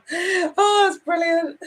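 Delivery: high, delighted laughing exclamation; real laughter. Real, not acted. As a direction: laugh through the words, pitch shooting up. Amused by viewer's sarcastic comment.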